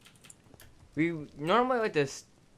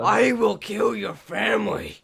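slow, high, loud menacing threat; raging gamer; 7 semitones higher, 6 dB louder than usual. Pretend. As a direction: spit out the over-the-top threat. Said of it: "The professionalism is strong".

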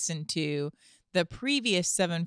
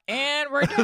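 louder, higher mock sign-off; imitating quitting listeners; 8 semitones higher, 5 dB louder than usual. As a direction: act out listeners bailing out. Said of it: "a bunch of you just were like".

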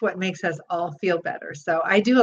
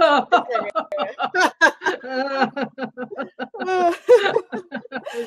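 a long spluttering laugh that slowly falls; long bursting laugh; 4 semitones higher than usual. Shown as a demonstration. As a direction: let it spill out as if through a mouthful of tea. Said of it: "it spits all over you".

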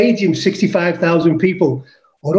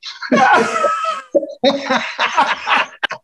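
real hearty shout of a laugh; big laugh. Real, not acted. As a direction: howl with laughter at the memory. Laughing at his own story.

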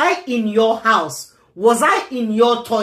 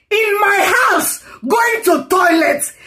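high, slow yelled words; angry shout; 10 semitones higher than usual. Real, not acted. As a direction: yell the complaint. Rant about being mocked.

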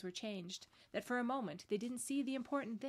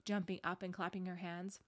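plain reading voice

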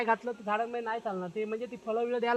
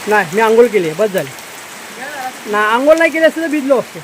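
loud, raised voice over pouring rain; calling over the rain; 13 dB louder than usual. Real, not acted. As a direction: shout above the downpour. Shouting over heavy rain.

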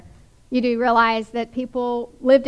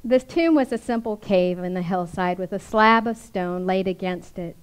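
usual speaking voice

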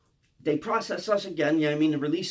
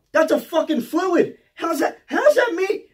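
loud, high, drawn-out disbelief; freaking out; 10 semitones higher, 7 dB louder, slower than usual. Retold or imitated. Inmate disputes the guard over mystery food, freaking out. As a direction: protest slowly and loudly, utterly baffled. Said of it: "I'm freaking out".

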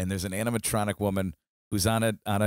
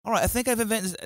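put-on failed Irish accent, higher pitched; 9 semitones higher than usual. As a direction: try an Irish accent and miss. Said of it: "that's not even an Irish accent".

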